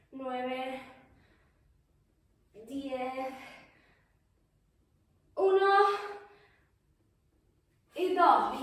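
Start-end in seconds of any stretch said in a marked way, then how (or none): 5.4-8.6 pushing out the last reps; loud strained count; loud effort push. Real, not acted. Última repetición, esfuerzo. (contando fuerte con esfuerzo)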